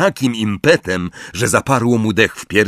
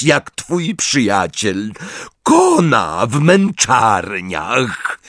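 higher, slow menacing threat; threatening Bellatrix; 5 semitones higher, slower than usual. A narrator's reading. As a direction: give him the ultimatum coldly. (groźny, powolny głos Bellatrix)

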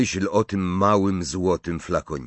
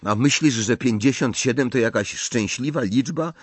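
high, lively character voices; animated dialogue voice; 8 semitones higher than usual. A narrator's reading. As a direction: argue it out in raised pitch. (ożywiony dialog, wysokim głosem)

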